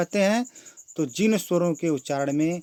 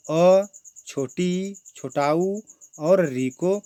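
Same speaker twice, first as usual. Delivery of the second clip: slow, stretched, louder drill of the vowels; slow drawn-out listing; 4 semitones higher, 6 dB louder, much slower than usual. Shown as a demonstration. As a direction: draw out each vowel name for the class. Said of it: "एक बार फिर से देख लेते हैं".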